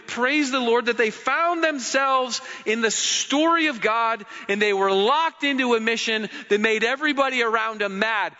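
normal talking voice

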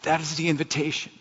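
real choked-up voice, halting with long pauses. Real, not acted. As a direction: fight back tears, breaking into a whisper. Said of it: "I'm getting choked up right now".

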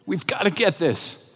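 soft, emotional plea; choked up. Real, not acted. Preacher urging the church after tearing up, choked up. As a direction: gather yourself and plead quietly through real emotion. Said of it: "I am getting choked up".

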